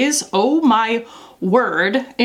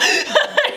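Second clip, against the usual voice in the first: high breathy laugh. Retold or imitated. Friend mocking her French.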